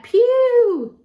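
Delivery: high, loud, drawn-out "pee-yew"; hammed-up reaction to a stink; playful disgust. Reacts to a smelly bug.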